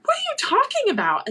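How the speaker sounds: fast, high-pitched protest; retold baffled outburst; incredulous. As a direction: blurt it high in disbelief. Told that she died.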